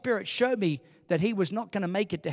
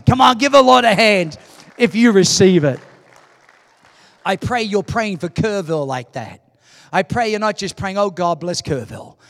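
loud pulpit exhortation; forceful public prayer; rallying preaching; 10 dB louder than usual. Real, not acted. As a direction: urge the congregation on at full volume. Rallies and prays over congregation.

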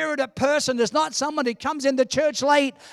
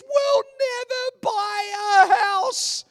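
crying out; 11 semitones higher, slower than usual. Retold or imitated. Wife crying over their tiny first paycheck. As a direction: wail it through tears. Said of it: "And Sharon cried".

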